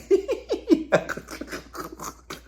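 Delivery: airy high laugh; high breathy cackle. Retold or imitated. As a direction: let out a breathy giggle. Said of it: "she starts laughing like".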